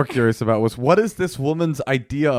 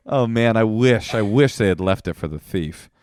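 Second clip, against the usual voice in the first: ordinary talk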